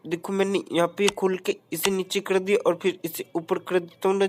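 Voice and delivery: normal talking voice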